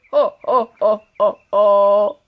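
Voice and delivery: a wolf's pleased goodbye and laugh; sly laugh. Acted. Wolf laughing after tricking the girl, loud.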